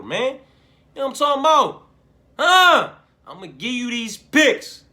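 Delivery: loud, high gambler yell; shouting with manic excitement; hyped shout. Real, not acted. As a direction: yell it like a proud degenerate. Hyped degenerate gambling rant.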